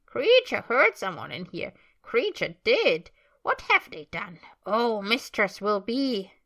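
loud, swooping house-elf fretting; slow, agitated creature wail; Kreacher. Acted. House-elf mutters about intruders in the kitchen, agitated creature voice.

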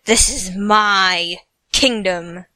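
low, slow, loud royal shout; shouting king. Acted. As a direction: bellow it like a tyrant king. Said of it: "Uther shouted back at him".

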